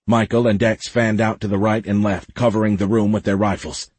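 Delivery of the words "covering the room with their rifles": plain reading voice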